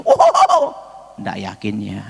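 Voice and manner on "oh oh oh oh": loud high "oh-oh-oh" wail; hammed-up church prayer howl; imitated over-fervent moaning, very high; mock prayer wailing. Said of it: "di gereja ada yang doanya".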